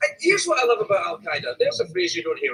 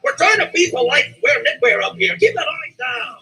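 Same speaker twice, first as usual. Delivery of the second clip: prissy Canadian neighbor; 6 semitones higher than usual. Retold or imitated. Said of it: "they're up there going".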